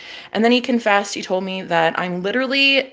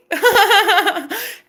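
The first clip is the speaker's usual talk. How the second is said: high, rolling laugh; cackle; 11 semitones higher than usual. Real, not acted. Woman cracking up at own taunt, cackling. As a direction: burst out cackling.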